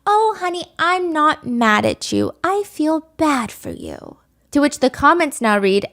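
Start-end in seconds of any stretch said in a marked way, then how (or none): 0.1-4.2 high, slow, syrupy mock-comfort; sugary fake tenderness, drawn out; fake sympathetic voice. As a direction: imitate a mother faking sympathy to her crying child. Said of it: "weird, fake, sympathetic voice".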